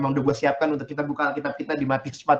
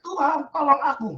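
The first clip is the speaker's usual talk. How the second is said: cry for help; 9 semitones higher than usual. Acted. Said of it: "lalu berteriak".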